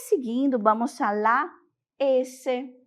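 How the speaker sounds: ordinary talk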